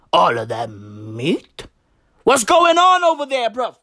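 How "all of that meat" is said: mock taunting low drawl; low slow taunt.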